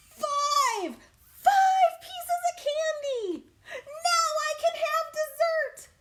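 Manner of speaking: high, loud squealing little pea voice; excited little pea. Acted. Little pea cheering about dessert, squealing high. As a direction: shriek with joy like a tiny pea.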